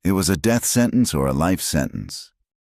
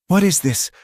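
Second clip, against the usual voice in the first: higher, sharper demanding question; shaky demand; 3 dB louder than usual. A narrator's reading. Captive woman at the church demanding, shaky. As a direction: demand an answer, voice pitched up and shaking. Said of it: "I demanded, my voice shaking".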